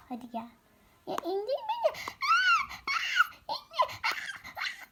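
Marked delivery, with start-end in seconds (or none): 1.1-4.0 high squealing giggle to be put down; put-me-down giggly squeal; squealing laugh. Acted. Child carried off, squealing. 4.0-4.9 breathless laugh. Acted.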